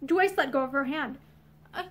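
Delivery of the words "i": plain reading voice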